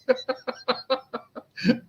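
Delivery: high real laugh that fades off softly. Real, not acted. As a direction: let out a high burst of laughter. Laughing at the comment.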